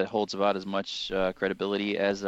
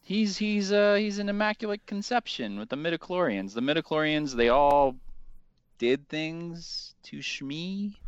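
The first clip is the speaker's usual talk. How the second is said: silly voice; 8 semitones higher than usual. Pretend. Answers in kept silly voice.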